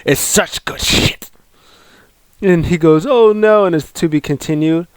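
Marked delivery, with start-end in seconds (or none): 0.0-1.3 rough, unpitched growly imitation; mock gruff boss voice; Vince McMahon. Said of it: "And then the Vince goes". 3.1-3.7 mock dismay. Retold dismay at boss's verdict.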